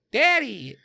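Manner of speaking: high mock daughter voice; daughter to dad. Spy daughter reporting back to her dad, high mock daughter call. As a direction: imitate a daughter calling out to her father. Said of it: "get back to her dad to say".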